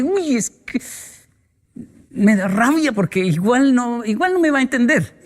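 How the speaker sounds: a hint of a fed-up parent mid-argument; frustrated parent. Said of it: "la discusión llega a un punto donde dice".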